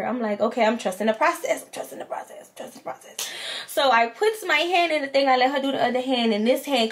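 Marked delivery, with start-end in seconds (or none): 1.6-3.2 whispered to herself, much softer. Retold or imitated. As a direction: whisper it like a private self-reassurance. Repeats line, then whispers it.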